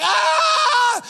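long, very high, loud scream; panicked scream. Retold or imitated. Reenacts his own panicked scream.

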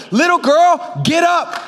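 high, loud command; commanding Jesus. Acted. Jesus commanding the girl to walk, high and loud. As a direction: order her to rise. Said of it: "he said".

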